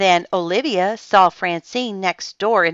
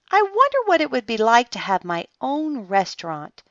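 high, wistful wondering; musing thought; 7 semitones higher than usual. A narrator's reading. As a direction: think aloud, drifting into a daydream. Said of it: "Olivia thought".

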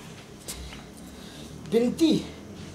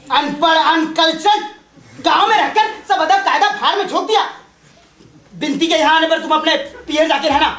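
usual speaking voice

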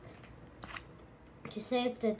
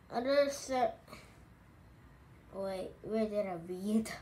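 plain reading voice

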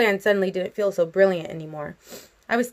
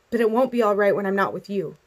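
ordinary delivery

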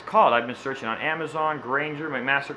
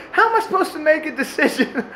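put-on whiny kid; 13 semitones higher than usual. The son shrugging to his mom, whiny and giggling. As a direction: plead helplessly like a cornered kid. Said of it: "raises his shoulders".

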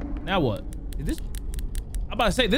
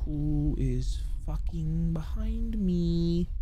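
scared whisper; 3 semitones lower, 8 dB quieter, much slower than usual. Real, not acted. Scared in a horror game.

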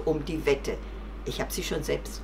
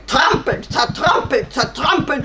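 loud, raised horse shout; stamping wild horse; 7 semitones higher, 9 dB louder than usual. Acted. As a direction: shout it like pounding hooves. Wild horse stamps and demands punishment. (laut stampfendes Wildpferd)

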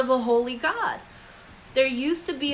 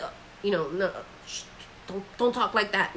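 hushed warning; 3 semitones lower, 6 dB quieter, faster than usual. Retold or imitated. Hushing friends before holy person.

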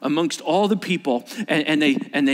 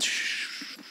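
whispered shh; hushing shh; 12 dB quieter than usual. Acted. Preacher hushing the congregation with a whispered shh. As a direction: hush the room.

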